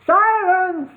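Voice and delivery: high, loud bark of a goblin king; villain barking an order. A narrator's reading. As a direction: silence them with a bark. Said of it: "barked Cluck".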